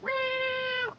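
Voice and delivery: high, soft mock meow; cat meow. Her cat begs for attention, high mock meow. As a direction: mew like a needy cat. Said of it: "she'll be like".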